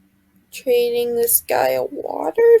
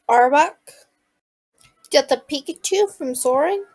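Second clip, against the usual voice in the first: usual speaking voice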